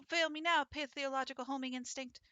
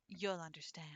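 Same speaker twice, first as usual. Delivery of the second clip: whispered; 5 semitones lower, 6 dB quieter than usual. Acted. Sparrow recalls losing someone.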